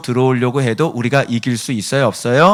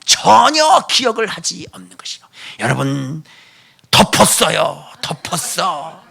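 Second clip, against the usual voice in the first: mock old-style preacher; 5 semitones higher than usual. Pastor mocks how he once preached repentance, overwrought and high.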